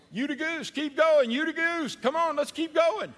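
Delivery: high, loud cheering goose. Pretend. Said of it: "what scientists have proven that these geese are saying".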